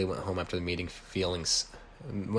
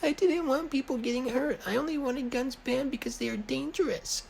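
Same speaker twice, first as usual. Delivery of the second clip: Riley's voice. A narrator's reading. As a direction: squeak it out way up high like a nervous kid. Riley voiced in story dialogue.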